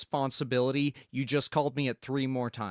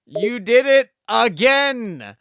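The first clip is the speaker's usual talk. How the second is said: slow, much louder, higher yell; outraged yell; 8 semitones higher, 13 dB louder, slower than usual. Acted. Inspector to the homeowner, outraged yell. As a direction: scream it in exasperation.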